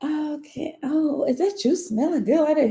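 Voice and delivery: high-pitched gushing admirer; fawning admirers. Retold or imitated. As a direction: put on a high, flirty fan voice. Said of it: "And they be like".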